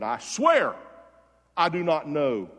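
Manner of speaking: loud, emphatic retold denial; mock swearing oath. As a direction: burst out on the oath, then punch each word. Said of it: "He used profanity".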